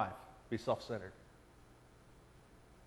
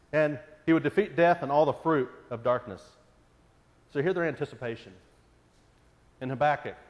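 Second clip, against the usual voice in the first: ordinary delivery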